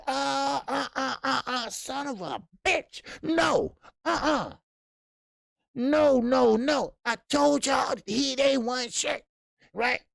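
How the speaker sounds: high, loud sputtering outrage. Retold or imitated. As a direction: sputter like a boss who just got played.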